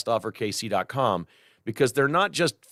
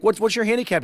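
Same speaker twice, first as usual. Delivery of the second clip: amazed question; 11 semitones higher, 3 dB louder, faster than usual. Retold or imitated. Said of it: "I'm like".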